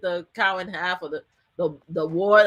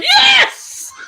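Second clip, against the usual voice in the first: high, loud, laughing shout of joy; triumphant yell; 7 dB louder than usual. Retold or imitated. Cheering in court as the guilty verdict lands, loud and laughing. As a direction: punch it out like you just won.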